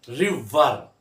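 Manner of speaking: रिवर slowly split into two syllables; drawn-out, broken-up रिवर. Shown as a demonstration. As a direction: say रिवर as two separate beats. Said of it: "ये दो syllable हो गए".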